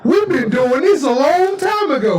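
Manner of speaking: high, drawn-out put-on voice; boastful ancient visitor. Ancient visitor showing up modern people, boastful. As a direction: pitch it up and stretch the boast.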